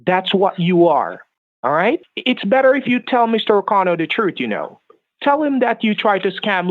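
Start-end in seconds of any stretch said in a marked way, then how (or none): none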